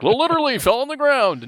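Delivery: "Literally fell on the ground" high and loud, hammed up; mock figurative exclamation. Shown as a demonstration. Speaker mocking the figurative use, hammed-up exclaim. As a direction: fling it out high like a hyperbole. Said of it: "Not like".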